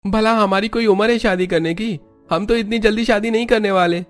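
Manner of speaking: very high, put-on character voice; protesting brother-in-law. (ऊँची बनावटी आवाज़ में विरोध)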